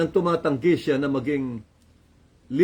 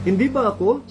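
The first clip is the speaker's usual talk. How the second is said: rhetorical rebuke; 8 semitones higher, 4 dB louder than usual. Acted. God rebuking Moses.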